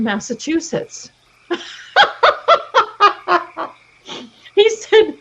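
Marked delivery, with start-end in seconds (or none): 1.5-3.7 high, loud burst of laughter. Real, not acted. Laughing hard at her Massachusetts joke, a loud high burst. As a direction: let a big laugh burst out.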